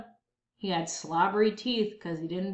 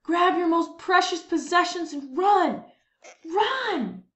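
much higher, louder shout; panicked yell; 8 semitones higher, 5 dB louder than usual. Acted. Men yell at townsfolk to run from the giant. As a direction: yell at everyone to flee. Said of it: "Men yelled".

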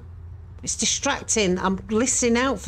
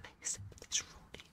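whispered, far softer plea; frightened whisper; 22 dB quieter than usual. Real, not acted. Viewer whispers a plea to the ghost hunter. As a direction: beg under your breath.

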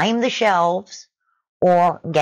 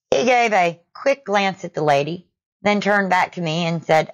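ordinary delivery

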